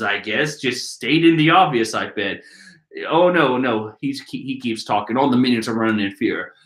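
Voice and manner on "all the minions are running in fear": ordinary talk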